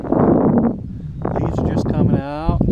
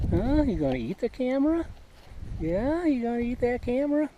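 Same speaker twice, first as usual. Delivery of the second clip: hushed, much higher, sing-song cooing; 8 semitones higher, 5 dB quieter than usual. Real, not acted. Vlogger coaxes a grazing horse, soft high cooing. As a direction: sweet-talk the horse into talking for the camera.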